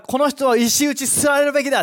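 loud, high-pitched accusation; accusing voice. Acted. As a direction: proclaim it loudly as an accuser. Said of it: "その責める声は". (責め立てる大声)

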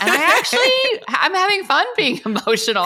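high, laughing through the words; giddy confession bubbling with laughter. Real, not acted. Laughing about becoming the emotional one.

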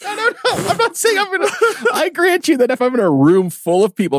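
очень высоко со смехом; отнекиваться смеясь; laughing denial. Real, not acted. Laughing protest.

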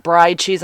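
"brie" said as "bry", rhyming with fry; imitating the mispronunciation. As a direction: mimic him saying "bry cheese". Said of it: "He'd call it brie".

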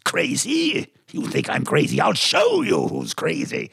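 a hint of Claude Rains, leaning on crazy; lightly voiced menacing madman; Claude Rains impression. Imitating Claude Rains for the interviewer, mild menace. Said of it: "one of the characters I used to imitate was, uh, Claude Rains".

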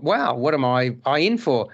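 ordinary talk

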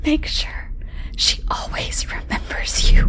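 menacing whispered threat. Acted. Milkman whispering a threat to Michael, hushed whisper. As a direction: breathe it, no voice at all. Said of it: "he whispered".

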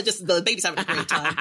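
ordinary delivery

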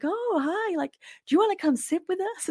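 high, friendly American stranger's invitation; imitated American accent, high-pitched. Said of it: "I'm gonna drop the American accent now".

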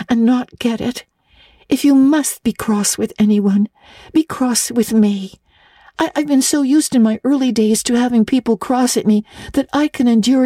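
put-on high, earnest girl's voice pleading a case; an orphan girl defending her friend; eager little girl Anne. A narrator's reading. Anne pleads with Miss Barry for Diana, high and earnest.

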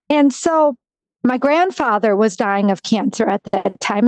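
ordinary talk

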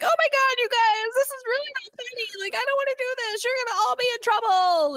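very high, fast, whimpering protest; whiny panic. Retold or imitated. Blindfolded XO begs the crew not to push him, whining. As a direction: squeal it like a coward about to fall. XO whining before being pushed.

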